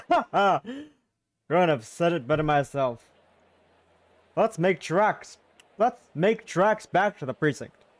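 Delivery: booming slow hearty "let's make tracks"; rally the group like a jolly big man; hearty character voice. Acted. Jolly companion urges the group back to the precinct, hearty and slow.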